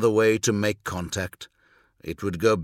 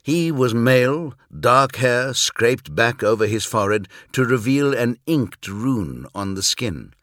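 ordinary delivery

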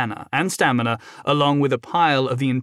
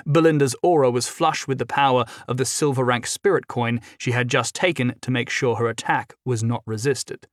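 ordinary delivery

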